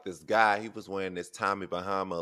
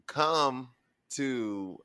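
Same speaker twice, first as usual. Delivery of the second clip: very slow, higher-pitched disbelief; loud, drawn-out incredulous question; amused incredulity; 4 semitones higher, 5 dB louder, slower than usual. Real, not acted. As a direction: draw out each word, pausing, as if baffled. Mock disbelief at the story.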